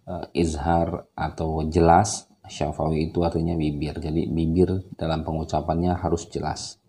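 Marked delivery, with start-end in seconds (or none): none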